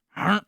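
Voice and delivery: sudden loud cat noise; short, high burst like a lunging cat; imitated cat attack. Streamer retelling how the cat went before biting, mock attack noise. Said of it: "he just went".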